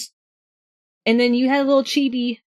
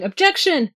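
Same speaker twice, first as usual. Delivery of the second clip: mild mock yell; 7 semitones higher than usual. Player recalls yelling into the game's microphone, mild mock yell. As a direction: call it out like a playful game catchphrase. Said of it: "I loved yelling".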